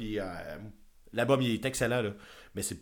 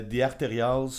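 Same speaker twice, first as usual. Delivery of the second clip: band name with French R and vowels; French accent; slower than usual. Real, not acted. Host naming a band to co-host, French accent. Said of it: "j'ai comme dit avec un accent français".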